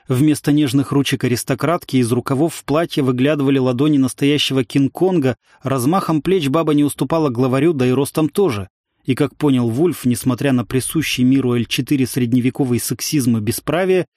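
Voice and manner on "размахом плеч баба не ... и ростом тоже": ordinary delivery, nothing marked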